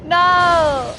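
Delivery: loud, high despairing shout; frustrated no. Real, not acted. Player seeing the wrong result, shouting no. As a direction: yell it at the screen. Pull misses wanted character.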